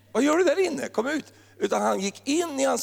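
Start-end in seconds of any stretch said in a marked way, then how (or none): 0.1-1.3 mock shout. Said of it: "stod inte ute och skrek på".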